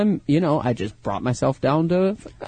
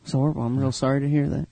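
low-pitched, lightly accented Tennessee movie line; old Tennessee accent; 4 semitones lower, 5 dB quieter, faster than usual. Shown as a demonstration. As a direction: deliver the line with a touch of Tennessee. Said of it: "put on your old accent".

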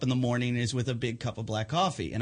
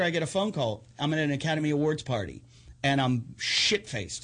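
ordinary talk

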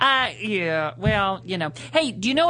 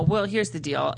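ordinary delivery

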